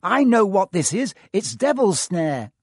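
urgent. Acted. Hermione commanding, urgent.

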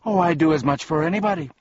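high, fast, loud reply; boastful fiend. A narrator's reading. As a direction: toss it off as a cocky little fiend. Boastful fiend answers Milo.

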